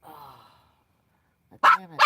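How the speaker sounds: ordinary delivery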